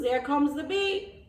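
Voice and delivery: ordinary delivery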